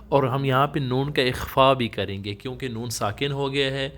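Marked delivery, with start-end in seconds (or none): none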